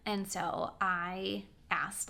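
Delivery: usual speaking voice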